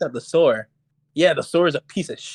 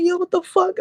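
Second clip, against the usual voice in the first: much higher, incredulous mock outburst; retold outrage. Confronting Steven over the car, incredulous outburst. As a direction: demand it in disbelief. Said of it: "so I'm getting pissed".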